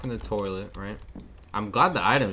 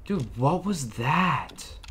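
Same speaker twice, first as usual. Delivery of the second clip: stunned; much slower than usual. Real, not acted. Shaken after jump scare.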